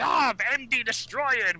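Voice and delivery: high, slow outraged cartoon villain cry; angry villain. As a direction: wail it like a sore loser villain. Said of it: "Robotnik's pissed off".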